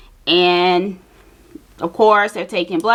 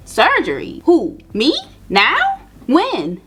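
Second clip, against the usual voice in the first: shocked disbelief; 6 semitones higher, slower than usual. Retold or imitated. Told she needs surgery now.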